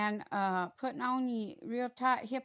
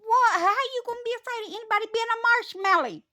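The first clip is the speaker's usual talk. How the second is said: loud, high, fast outburst; indignant; 12 semitones higher, 10 dB louder, faster than usual. Real, not acted. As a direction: fire it off in disbelief. Indignant at the soft approach.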